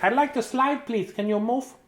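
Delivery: high-pitched mouse voice; little mouse. Acted. Mouse asking to use the slide, tiny voice. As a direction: squeak it politely as a mouse. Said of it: "The mouse is like".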